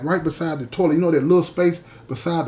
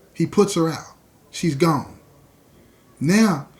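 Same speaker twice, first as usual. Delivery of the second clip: ordinary delivery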